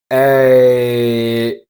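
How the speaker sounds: "A" drawn out, sliding from eh towards ee; slow vowel glide. Shown as a demonstration. Teacher shows the middle vowel, "A" as a slow glide. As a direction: hold "A" long and glide slowly from eh up towards ee. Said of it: "start with E and slowly move towards E".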